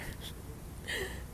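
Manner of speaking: high airy giggle under her breath; breathy giggle. Real, not acted. Outside on her walk, a light breathy giggle. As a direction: laugh off the bad mood. Laughing to herself after walk.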